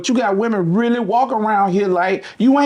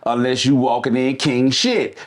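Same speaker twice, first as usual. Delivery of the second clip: usual speaking voice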